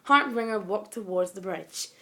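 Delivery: usual speaking voice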